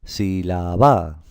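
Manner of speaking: "Sílaba" stressed on the end, si-la-BÁÁ; wrong final stress. Shown as a demonstration. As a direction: stretch it slowly and punch the last syllable, si-la-BÁ. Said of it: "las pronunciamos en voz alta". ("Sílaba" mal acentuada en la última: si-la-BÁ)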